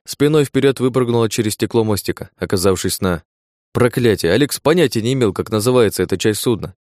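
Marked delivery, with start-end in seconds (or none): none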